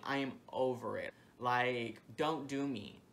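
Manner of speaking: ordinary delivery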